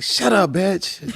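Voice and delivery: high, drawn-out mock outrage; exasperated retort. Comic back at his heckler, high mock-angry retort. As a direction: pitch it high and slow, fed up.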